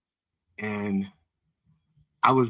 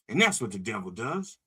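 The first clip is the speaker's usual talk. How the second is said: ordinary delivery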